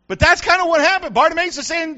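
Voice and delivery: high, loud pulpit delivery; full-force preaching. Real, not acted. Pastor telling the congregation what happened, fervent preaching.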